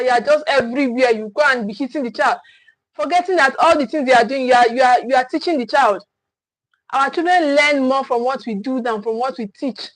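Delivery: usual speaking voice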